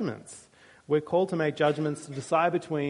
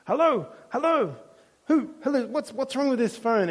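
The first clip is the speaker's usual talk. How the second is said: high, loud mock yell of an old man at the phone; angry shopkeeper; 9 semitones higher, 3 dB louder than usual. Old shop owner yelling into a silent phone, mock angry. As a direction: ham up the shop owner barking into a dead phone.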